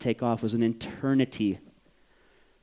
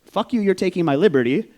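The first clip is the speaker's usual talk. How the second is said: raised, higher voice, short of screaming; mock yell, half the original force; retold outburst; 7 semitones higher, 6 dB louder than usual. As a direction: recount the yell loudly, in quick replay. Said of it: "what they heard outside was".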